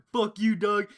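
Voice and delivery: high, loud heckle; loud taunting yell. Retold or imitated. Teller taunting the kid through the window, loud high yell. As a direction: yell it as a jeer.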